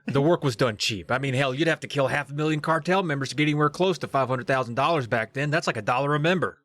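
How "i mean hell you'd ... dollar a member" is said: ordinary talk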